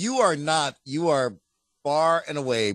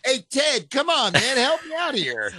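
mock pleading; 9 semitones higher than usual.

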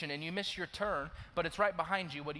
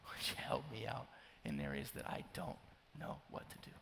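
hushed, softer plea for help; whispered prayer; 4 semitones lower, 7 dB quieter than usual. Pretend.

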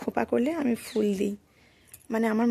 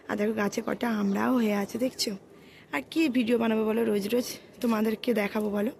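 ordinary talk

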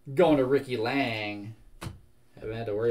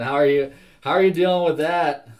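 louder, higher, playful call-out; big teasing hello; loud greeting; 5 semitones higher, 11 dB louder than usual. Real, not acted.

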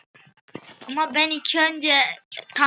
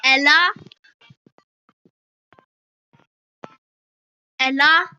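called out loudly; calling out; 5 dB louder than usual. Real, not acted. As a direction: call the name out clearly. Spelling name out in game chat.

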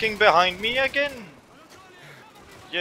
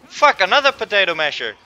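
ordinary talk

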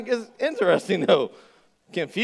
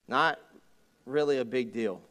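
usual speaking voice

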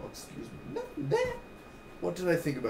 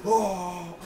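high gasp into a high oh; gasp, then a surprised oh; a fan gasping at a twist; high shocked gasp; 7 semitones higher, 4 dB louder than usual. Retold or imitated. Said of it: "I was like".

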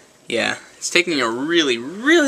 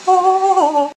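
shocked cry; 9 dB louder than usual. Real, not acted. Reacting to clip, loud high.

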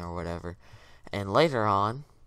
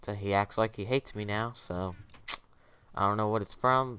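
ordinary talk

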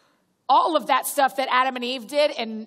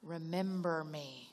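soft and slow, lower, drawn out; hushed, slow; 8 semitones lower, 12 dB quieter, slower than usual. Shown as a demonstration. Preacher quoting Jesus to the congregation, hushed and slow. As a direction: let "Remember me" land low and slow.

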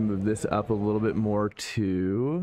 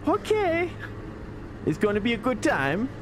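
excited; 11 semitones higher than usual. Real, not acted. Pumped before race start.

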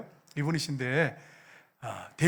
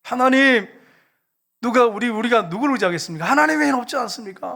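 high, loud reenacted plea to God; imitated desperate prayer shout; crying out in prayer; 6 semitones higher, 6 dB louder than usual. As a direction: cry out to God, voice raised. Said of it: "소리를 지르면서 기도를 했대요".

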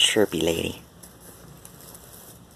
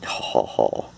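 whispered chuckle; 12 semitones lower than usual. Real, not acted.

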